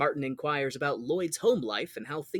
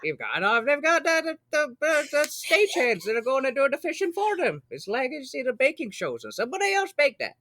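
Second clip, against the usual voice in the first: higher, hammed-up Midwestern accent; 10 semitones higher than usual. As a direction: play a Midwestern guy scoffing at TV fishing. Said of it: "that's my Midwesterner".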